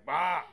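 booming mock prophet shout; prophet bellow. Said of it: "prophet voice".

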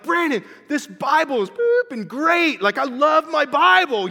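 high, loud gushing to a roommate; excited yelling. Retold or imitated. New believer calling to his roommate about the Bible, excited yell. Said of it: "I'm yelling to Brandon".

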